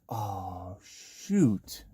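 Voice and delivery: soft, sinking reaction under the breath; dismayed aside. Retold or imitated. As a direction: let dismay out quietly. His own dismayed inner reaction.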